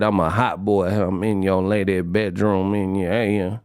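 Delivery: put-on low, drawled New Orleans hot-boy voice; imitating a New Orleans rapper, low and lazy. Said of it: "sounding like one of them hot boys".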